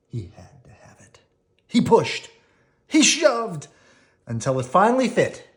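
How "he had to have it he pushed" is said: hushed storybook whisper. A narrator's reading. Parent whispering to child at bedtime. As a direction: breathe it like a secret.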